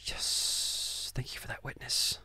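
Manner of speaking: sarcastic whispered thought; unvoiced sarcastic whisper, said to himself; inner whisper. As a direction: breathe it as a private dry aside. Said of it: "And I'm, in, inside".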